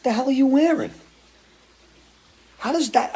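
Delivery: ribbing a bandmate over his clothes, high mock disbelief. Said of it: "I look at him sometimes in the dressing room and I go".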